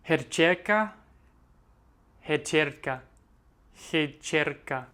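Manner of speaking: Herčerka tried slowly, syllable by syllable; practising a hard word. Shown as a demonstration. Learner practising a hard Slovene word for viewers, careful. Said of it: "this word is so hard for me".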